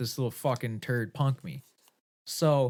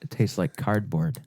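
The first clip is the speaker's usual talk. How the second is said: mock disgust; 3 semitones lower, slower than usual. Joking disgust at the pizza chain.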